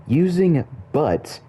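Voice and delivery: "Using" high and loud, then "but" pointed; stress on "but". Shown as a demonstration. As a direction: lean hard on "Using" and "but". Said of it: "makes it seem like a con".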